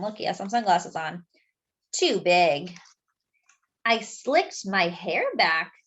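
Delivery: usual speaking voice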